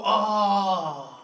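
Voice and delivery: mock pitying sigh of an oh. Brits pitying a Greek man's family, mock sorrowful oh. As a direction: sound deeply sorry for him.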